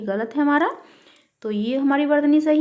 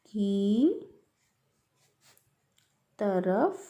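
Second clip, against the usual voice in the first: very slow, word by word, softer; slow dictation; 4 semitones lower, 4 dB quieter, much slower than usual. Shown as a demonstration. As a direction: dictate each word and wait for the pen. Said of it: "तो हमें सही वर्तनी कैसे लिखेंगे".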